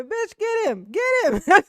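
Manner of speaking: high, loud pretend cry for help; mock panicked scream. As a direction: scream it like a comic coward in hiding.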